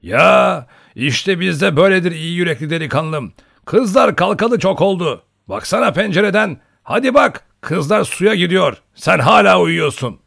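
loud, high, hearty calling; old hunter's booming teasing; boisterous rousing. A narrator's reading. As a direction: chivvy a sleeper out of bed loudly. Hunter noisily rouses sleeping Olenin.

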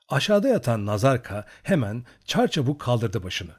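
ordinary delivery, nothing marked

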